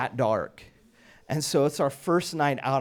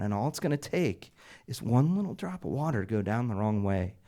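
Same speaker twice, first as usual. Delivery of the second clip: ordinary delivery, nothing marked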